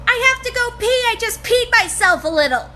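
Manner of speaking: put-on high, loud child's voice; imitated little girl. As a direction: blurt it out like a small kid. Loud little girl's announcement, acted.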